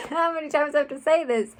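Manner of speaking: exasperated laugh, rushed and loud; fed-up laughing rant. Real, not acted. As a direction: laugh it off mid-rant, fast.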